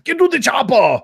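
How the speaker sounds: high, hammed-up Austrian accent yell; urgent Austrian-accented action-hero order; Arnold.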